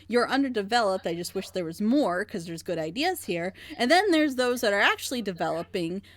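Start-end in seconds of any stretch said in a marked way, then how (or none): none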